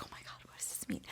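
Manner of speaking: quick whispered inner freak-out; panicked whisper. Retold or imitated. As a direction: whisper fast, as a secret thought. Retells her panicked inner thought.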